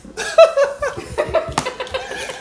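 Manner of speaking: squealing high laugh; high laughter. Real, not acted. Laughs at her own story.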